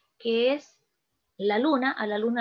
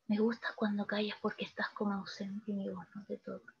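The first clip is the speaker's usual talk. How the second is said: soft recited verse; 4 semitones lower, 10 dB quieter than usual. Acted. Teacher quoting Neruda to students, softly recited. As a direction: read the verse much softer.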